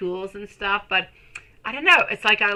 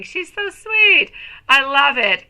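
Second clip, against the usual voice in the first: high, drawn-out gush of delight, much higher and slow; 6 semitones higher, 8 dB louder, slower than usual. Real, not acted. Streamer gushing to chat about Lilith's crochet, high and slow. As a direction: stretch out the sweet like you're melting.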